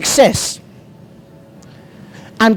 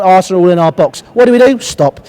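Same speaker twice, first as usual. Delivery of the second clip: ordinary talk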